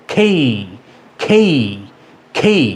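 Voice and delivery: "খেই" with puffed k, gliding e to i; corrected letter name. Shown as a demonstration. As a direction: model "খেই" with breath after k and an ee ending. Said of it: "উচ্চারণ কি হলো?".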